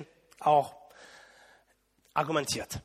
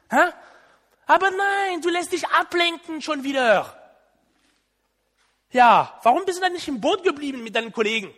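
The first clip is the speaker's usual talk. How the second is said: raised, exasperated told-you-so nagging; sarcastic scolding; 5 semitones higher, 4 dB louder than usual. Pretend. Preacher plays a Jesus scolding sinking Peter, sarcastic. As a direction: play the annoyed rescuer who rubs it in.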